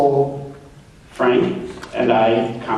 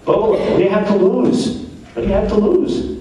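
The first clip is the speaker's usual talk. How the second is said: higher, pleading genie voice; 6 semitones higher than usual. Acted. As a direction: coax him like a pushy genie, pitched up.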